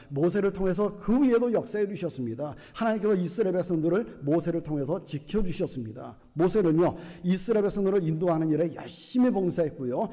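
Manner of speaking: ordinary talk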